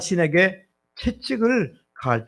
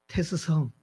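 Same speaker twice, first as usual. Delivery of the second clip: put-on softer Chungcheong-style call to an older brother; Chungcheong dialect; 4 dB quieter than usual. Shown as a demonstration. Preacher showing how Chungcheong people address Socrates, in Chungcheong dialect. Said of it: "충청도 말로는".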